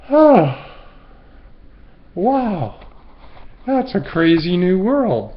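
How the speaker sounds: loud, high, wide-eyed wonder; amazed. Retold or imitated. Freed from OCD, amazed at the world, loud and high. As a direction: marvel at a brand new world.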